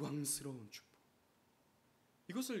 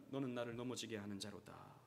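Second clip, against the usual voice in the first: low, softer, grave rebuke; quiet rebuke; 4 semitones lower, 6 dB quieter than usual. Acted. Jesus rebuking Peter, a low grave rebuke. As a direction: say it low and heavy. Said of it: "예수님께서 베드로를 꾸짖으신 거죠".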